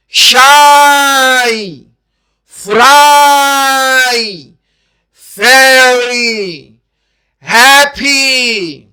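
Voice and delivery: each English word said very slowly and carefully; slow model words. Shown as a demonstration. Teacher modelling example words slowly. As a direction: pronounce شائی, فرائی, فیری, ہیپی slowly for the learner to repeat. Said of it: "Related words with their sounds".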